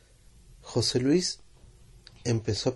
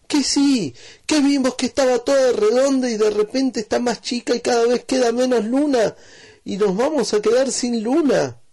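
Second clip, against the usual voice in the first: put-on far higher, louder child's pleading; urgent insisting; 13 semitones higher, 6 dB louder than usual. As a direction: insist the moon is vanishing.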